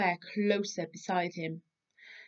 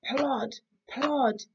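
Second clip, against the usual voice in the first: Little Bear imitating; 6 semitones higher, 4 dB louder, much slower than usual. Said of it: "said Little Bear".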